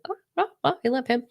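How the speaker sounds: high, swooning coos of affection; gushing love. Acted. Thinking of her cat Trevor, high swooning delight. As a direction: melt over a beloved pet cat.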